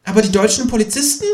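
high, quick questioning kangaroo; high-pitched, hurried interjection; interjecting kangaroo. A narrator's reading. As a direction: jump in fast with a high objection. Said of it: "wirft das Känguru ein".

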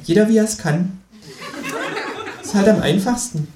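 high Kangaroo voice with a laugh in it; amused kangaroo. Acted. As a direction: needle him as the chuckling Kangaroo.

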